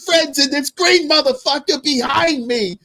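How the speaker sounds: high, hollering mock shout-out; shouting out friends on ESPN, hammed-up holler. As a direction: yell it like a rowdy fan on TV.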